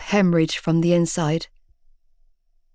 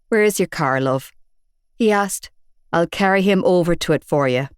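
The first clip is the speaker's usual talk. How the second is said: a hint of a gruff Belfast man; Belfast accent; 2 semitones lower than usual. Big bearded man telling off Shane, in a Belfast accent. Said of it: "distinctive Belfast accent".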